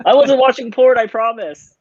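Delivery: high-pitched hammed-up guilty protest; mock panicked denial. Husband caught by his wife on his phone, mock panicked. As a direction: blurt a mock-defensive excuse, voice jumping up high.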